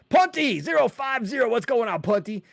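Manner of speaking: high, punchy name call; hyped shout-out. Real, not acted. Host hailing a viewer by handle, hyped. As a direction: announce the handle with energy.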